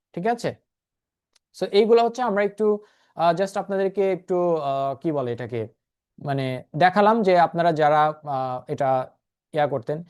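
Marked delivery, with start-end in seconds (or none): none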